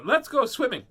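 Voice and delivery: put-on high, loud eager kid voice; Kyler. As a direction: imitate a child eagerly proposing it. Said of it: "So Kyler says".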